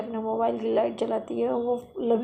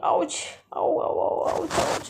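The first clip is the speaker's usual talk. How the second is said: mock ouch cries; 6 semitones higher, slower than usual. Didi hurts herself at door.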